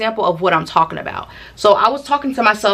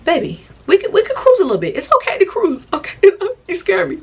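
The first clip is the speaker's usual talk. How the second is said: put-on much higher coaxing baby voice; high sweet coaxing; 11 semitones higher than usual. Telling her friend to cruise, high coaxing voice. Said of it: "she was in the car".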